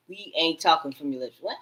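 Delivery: normal talking voice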